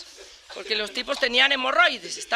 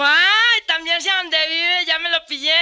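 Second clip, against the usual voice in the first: put-on gleeful kid gloating; 4 semitones higher, slower than usual. Kid taunting the old man he caught, gleeful and high. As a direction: crow it like a cheeky kid who's won.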